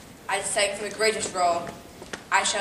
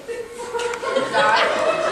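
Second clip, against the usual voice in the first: drawn-out flirty; 13 semitones higher, much slower than usual. Acted. Drawn-out breathy compliment.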